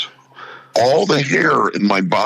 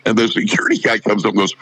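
ordinary talk

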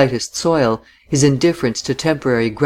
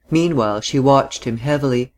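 ordinary delivery

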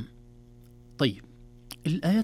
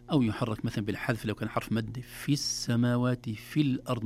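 ordinary talk

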